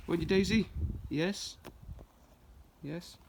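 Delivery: sing-song pet voice; high coaxing dog talk. Real, not acted.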